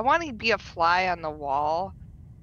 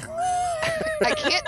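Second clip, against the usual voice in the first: scream effect voice; 4 dB louder than usual. Pretend.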